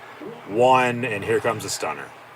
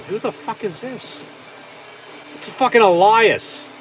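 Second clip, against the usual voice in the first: shocked disbelief; 12 semitones higher than usual. Real, not acted. Watching the entrance, shocked at the reveal, high and incredulous. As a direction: squeak out your disbelief, then blurt the name.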